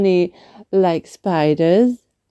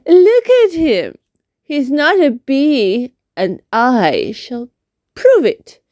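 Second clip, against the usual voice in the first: pitched-up stubborn bee voice; Amelia insisting; 6 semitones higher than usual. Acted. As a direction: insist like a clever little bee. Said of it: "said Amelia".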